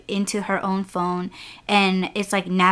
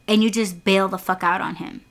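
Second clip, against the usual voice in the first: normal talking voice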